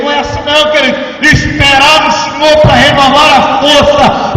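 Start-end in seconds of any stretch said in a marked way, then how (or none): 1.2-4.4 loud, higher fervent preaching; impassioned sermon climax; full-force preaching. Acted. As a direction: preach it with fire to the congregation.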